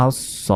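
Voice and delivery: আস স as os-so, long heavy s; doubled consonant. Shown as a demonstration. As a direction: hold the s of আস into স. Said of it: "তাজদিদের উচ্চারণ দুইবার হয়". (আস স, স দুইবার)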